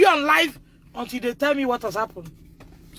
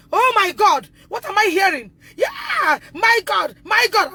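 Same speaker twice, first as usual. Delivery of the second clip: outraged shock; 6 semitones higher than usual. Real, not acted. Mother's outraged exclamation.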